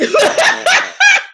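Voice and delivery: loud, high cackle; bursting out laughing. Real, not acted. Host cracking up at own impression, loud high laughter. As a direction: lose it completely in a shrieking laugh. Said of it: "I gotta stop laughing".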